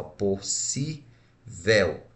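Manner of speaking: "possível" very slow, syllable by syllable; separado em sílabas. Shown as a demonstration. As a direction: break "possível" into pos, si, vel with pauses. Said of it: "quando eu separo a palavra possível". ("possível" sílaba por sílaba, bem devagar)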